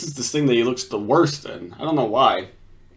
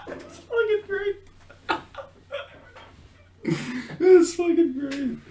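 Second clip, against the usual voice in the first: high squeaky words through laughter; helplessly amused; laughing hard; 3 dB quieter than usual. Real, not acted. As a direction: wheeze it out between real laughs. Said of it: "why I'm laughing so much".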